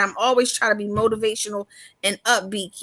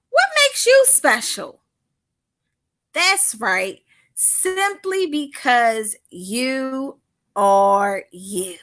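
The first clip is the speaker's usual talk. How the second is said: put-on teacher talking to kids; 2 semitones higher, slower than usual. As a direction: coax the little ones to answer. Addresses children in audience.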